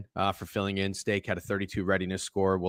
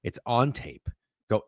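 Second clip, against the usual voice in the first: usual speaking voice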